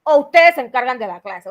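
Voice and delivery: loud and firm, laying down the law to pupils; firm, loud teacher voice. Shown as a demonstration. Teacher demonstrating her firm voice to disruptive pupils, loud. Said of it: "sale así firme y fuerte".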